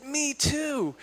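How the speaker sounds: eager agreement. Retold or imitated. Man delighted again.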